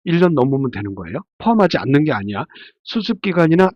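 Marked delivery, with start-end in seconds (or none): none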